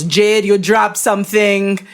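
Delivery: put-on slow, stiff German accent. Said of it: "Was that your German accent?".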